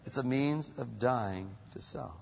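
ordinary talk, nothing marked

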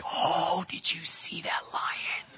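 hushed, breathy mock whisper of amazement; imitating men marvelling at the lion; awed guys. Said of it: "the guys are like".

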